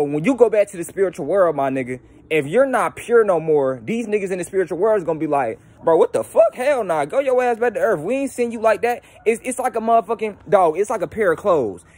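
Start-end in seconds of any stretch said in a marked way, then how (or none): 5.8-9.0 higher-pitched, incredulous refusal; indignant rejection. Acted. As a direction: send them packing, outraged and high. Spirits indignantly reject returning soul.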